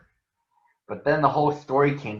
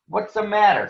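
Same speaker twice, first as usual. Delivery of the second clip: sneering; 8 semitones higher than usual. Acted. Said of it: "sneered Too Tall".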